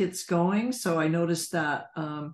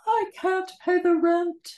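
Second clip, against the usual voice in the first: put-on high, pleading young widow; helpless victim voice; 11 semitones higher than usual. Shown as a demonstration. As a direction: squeak it out helplessly. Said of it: "Into Savannah".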